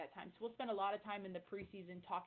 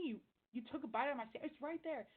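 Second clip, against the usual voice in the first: higher, faster, half-joking indignation; mock outrage; 6 semitones higher than usual. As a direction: act the competitive teammate caught off guard. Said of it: "that D is like".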